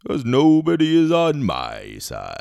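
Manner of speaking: slow, drawn-out tree voice, rising loud at the end; mock Treebeard. Treebeard answering Merry, slow ponderous mock voice. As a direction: stretch it out like a ponderous old tree. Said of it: "He's like".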